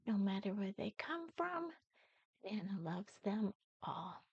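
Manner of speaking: soft tender whisper. Acted. Nana whispers her love for all children. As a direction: let it fall to a whisper.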